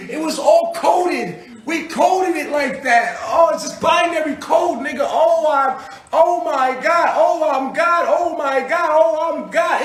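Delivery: slow, high, loud shouted revelation; ecstatic shouting. Acted. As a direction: preach it like a madman who found the code.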